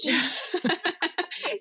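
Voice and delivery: high, bubbly laugh; high-pitched giggling; amused laugh. Real, not acted. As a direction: laugh at your own understatement. Both laugh at her understated reaction.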